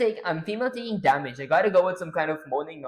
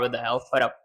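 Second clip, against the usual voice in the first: normal talking voice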